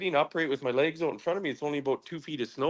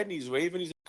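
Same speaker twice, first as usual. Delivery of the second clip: usual speaking voice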